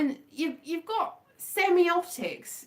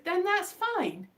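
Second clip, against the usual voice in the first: sincere acceptance; 8 semitones higher, slower than usual. Real, not acted. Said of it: "that sounded very sarcastic".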